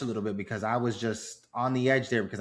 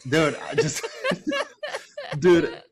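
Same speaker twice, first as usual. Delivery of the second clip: laughing through emotion; 9 semitones higher, 4 dB louder than usual. Real, not acted. Laughing while choked up recounting.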